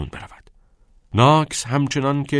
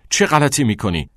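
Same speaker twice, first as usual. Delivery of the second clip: loud, higher furious demand; angry yell; 5 semitones higher, 5 dB louder than usual. A narrator's reading. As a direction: yell it in outrage. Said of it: "چت فریاد کشید". (فریاد خشمگین)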